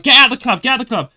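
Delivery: imitated angry yell, high and very loud; mock furious shout, repeated fast. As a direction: yell it like a woman throwing someone out. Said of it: "shouting at me, shouting at me".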